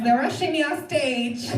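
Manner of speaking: ordinary talk, nothing marked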